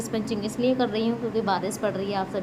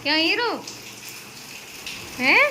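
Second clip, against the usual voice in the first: playful cooing; 9 semitones higher, 3 dB louder than usual. Real, not acted. Wife cheering her husband standing up, high doting coo. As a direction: coo at him like a proud mother.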